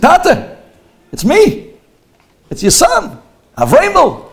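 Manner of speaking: loud high call to his father. Retold or imitated. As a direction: shout to get Dad's attention. Son calling to father at harbor.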